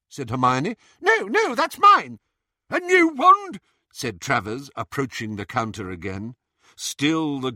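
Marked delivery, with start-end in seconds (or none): 1.0-2.2 very high, loud panicked denial; sharp protest. A narrator's reading. Hermione panicking as Bellatrix. 2.7-3.6 very high, loud disbelief; a startled sneer; surprised. A narrator's reading. Travers surprised.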